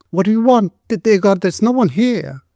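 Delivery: high, frightened woman's voice; terrified. A narrator's reading. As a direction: plead in fear from behind a door. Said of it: "said this terrified voice on the other side".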